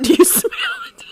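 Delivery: very high, mocking squeal on you; mock the phrase in a squeaky laugh; squealed mock quote; mocking squeak. Shown as a demonstration.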